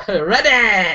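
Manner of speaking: high, hammed-up gladiator-show call; gladiators-show announcer. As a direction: boom it like a game-show referee starting contestants. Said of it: "It's like gladiators".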